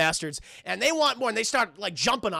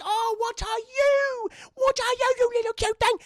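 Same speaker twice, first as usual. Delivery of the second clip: put-on curious little girl; 14 semitones higher, 3 dB louder than usual. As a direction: coo at a tiny creature as a child. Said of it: "She's like".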